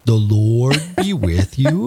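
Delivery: thick North Dakota accent, slow. Shown as a demonstration. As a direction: drawl it out in North Dakota style. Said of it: "throw on a really thick North Dakota accent".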